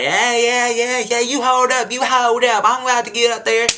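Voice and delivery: high-pitched mock holler, pushed hard; hollering girl imitation. Retelling a girl's outburst on the bus, mock holler.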